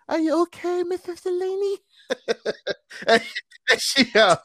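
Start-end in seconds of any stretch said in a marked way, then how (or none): 0.1-1.8 much higher, squeaky third-grader voice with a laugh; tiny kid voice. Retold or imitated. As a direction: imitate his tiny third-grade self asking his teacher. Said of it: "So I probably sounded".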